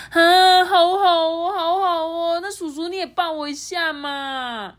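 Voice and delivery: high, loud wheedling that softens at the end; begging kids. Acted. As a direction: kids pleading for a hug.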